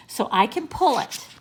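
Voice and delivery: lightly voiced gleeful boy; happy. A narrator's reading. Benny explains why he wants that tail, happily. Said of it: "Benny happily".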